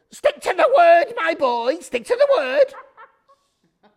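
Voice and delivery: high, loud mock-witch screech, slowing at the end. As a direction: screech it out like a finger-wagging storybook witch. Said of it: "that looked like a witch".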